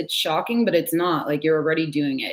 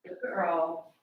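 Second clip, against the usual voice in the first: soft praise; 9 dB quieter than usual. Real, not acted. Praising dog softly.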